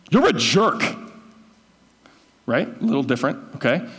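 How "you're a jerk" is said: higher, louder angry snap; mock furious insult at a friend. Shown as a demonstration. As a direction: spit it out in real anger. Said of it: "The second, I'm furious".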